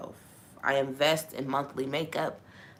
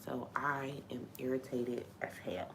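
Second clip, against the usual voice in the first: whispered; 10 dB quieter than usual. Real, not acted. Venting frustration after long pause.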